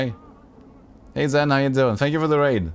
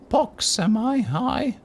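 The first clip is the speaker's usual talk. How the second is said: put-on very high, small girlish voice; girl of ten voice; 10 semitones higher, much slower than usual. Player voicing hooded Pox in a high girlish voice. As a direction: squeak it out like a ten-year-old girl. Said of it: "high-pitched voice ... girl of ten years".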